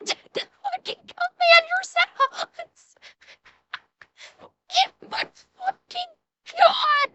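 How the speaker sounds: high, breathless laughing disbelief; cracking up too hard to finish; helpless laughter. Real, not acted. Co-host hearing the shell hit the commander's yard, laughing helplessly.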